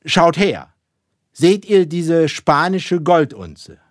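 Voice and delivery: high, loud captain addressing his crew; rousing shipboard address; commanding captain. A narrator's reading. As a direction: call out slowly to the whole crew.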